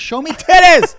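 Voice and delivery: high, loud, insistent yell; mock-frantic shout. Friend talking over host about VR porn, mock-frantic shouting.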